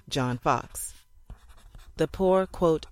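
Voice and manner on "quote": plain reading voice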